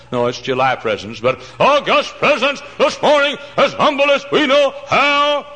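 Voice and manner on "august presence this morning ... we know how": much higher, louder and slow, sung-out mock prayer; drawn-out holy tone mocking formal preachers; pompous preacher prayer. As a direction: ham up a stuffy pulpit prayer. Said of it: "you know why they talk like that?".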